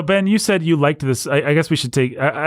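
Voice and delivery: ordinary delivery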